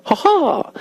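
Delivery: high, short "ha ha"; read-out laugh. Acted. DJ reading a student's message, high mock laugh. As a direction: act out a typed chuckle, pitched high.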